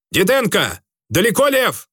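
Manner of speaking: high calling shout. A narrator's reading. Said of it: "крикнул вестовому Георгиади".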